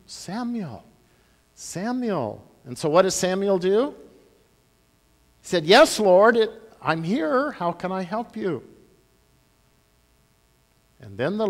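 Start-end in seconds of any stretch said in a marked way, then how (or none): 0.1-2.5 high, falling call. Acted. The voice comes to Samuel once more, calling out. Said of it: "the voice came". 5.6-8.6 high, loud start, then easing off; eager answer. Acted. Samuel answers the Lord's call, eager reply.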